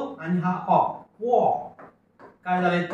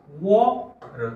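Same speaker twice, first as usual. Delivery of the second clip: slow model word; much slower than usual. Shown as a demonstration. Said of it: "वॉर शब्द वाचा".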